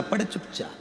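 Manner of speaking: hushed command. Acted. Guru hushes the rising corpse.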